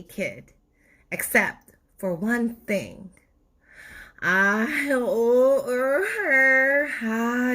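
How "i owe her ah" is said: slow, higher, pained tooth whine; aching tooth voice. Acted. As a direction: drag it out like a throbbing tooth. Tooth's voice moaning in pain.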